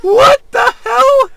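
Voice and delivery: high squealing what the hell; shocked laughing shout. Real, not acted. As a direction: yell it in amused disbelief. Bursts out laughing at text.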